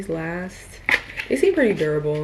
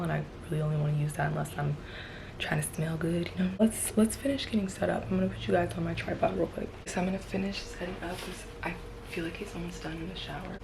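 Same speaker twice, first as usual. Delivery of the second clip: whispering; 7 dB quieter than usual. Real, not acted. Hushed while partner in shower.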